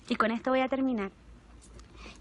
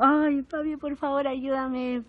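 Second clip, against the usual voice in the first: tearful plea for help; 2 semitones higher, 3 dB louder, much slower than usual. Retold or imitated. To her heavenly father, retold tearful plea. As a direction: beg through tears, lightly imitated. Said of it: "llorando así como".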